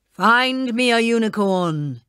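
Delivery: slow, imperious king voice; commanding king. Acted. King orders his page Toby, slow imperious command. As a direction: demand it like a spoilt king. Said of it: "he cried".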